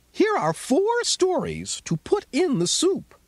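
squeaky mouse voice. Acted. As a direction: voice the little mouse, high and bright. Mouse speaks in story.

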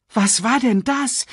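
high, startled question; gasped. A narrator's reading. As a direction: gasp it out after the blast. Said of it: "keuchte Nala".

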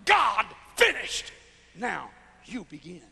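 slow, falling to a hush on the second part; slow divine decree. Acted. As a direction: lay it down slowly like a decree. Quoting God, slow and dropping.

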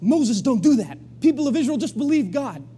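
half-joking outburst at the story; exasperated scolding. Retold or imitated. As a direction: scold the Bible characters like an impatient reader. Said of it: "we're just like".

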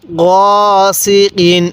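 গ held long and level, then সি কিন; recitation model. Shown as a demonstration. Teacher showing the learner how to read, drawn-out গ সি কিন. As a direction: draw out গ before measured সি কিন. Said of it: "এরকমভাবে শিখব".

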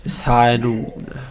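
slow mock Western drawl; bad Western accent. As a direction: badly imitate a Western gunslinger, drawn out. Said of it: "Western accent going".

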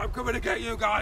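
fast shouted mock bear threat; a hint of a scary bear chasing the kids. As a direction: growl it out quickly as a playful bear. Teacher plays the bear.

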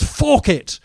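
high-pitched exclamation; acted outburst. Acts out his audition line.

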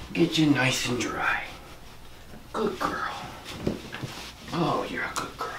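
soft breathy praise to a dog; whispered dog praise. Real, not acted. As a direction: hush and soothe the dog as you dry. Soothing dog while drying.

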